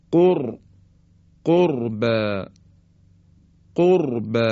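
قرب with thick ra; heavy ra. Shown as a demonstration. Teacher demonstrating ra after damma. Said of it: "مثل".

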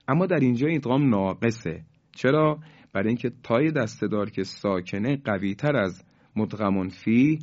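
ordinary talk

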